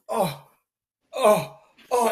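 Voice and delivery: mock aching "oh", spaced out; pained groans. Teller faking pain to the doctor, pained groans. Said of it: "It hurts all the time".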